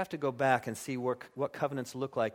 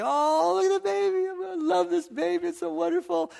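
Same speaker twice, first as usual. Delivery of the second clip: put-on much higher, gushing over the baby; mock baby cooing; 5 dB louder than usual. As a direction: coo like a doting new parent.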